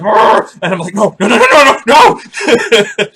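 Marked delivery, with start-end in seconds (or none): 0.0-0.5 loud high growled heartbeat sound; racing heart noise. Retold or imitated. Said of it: "my heart's like". 0.9-3.1 high, loud panicked stream of no; panicking no. Retold or imitated. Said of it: "Like, panicking".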